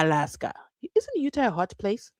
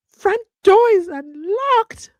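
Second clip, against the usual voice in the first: high, loud, drawn-out alarm; startled and stretching each word; real alarm; 12 semitones higher, 9 dB louder, slower than usual. Real, not acted. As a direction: cry out slowly in high-pitched alarm. Hears sounds, finds door unlocked.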